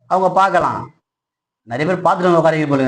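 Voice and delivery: usual speaking voice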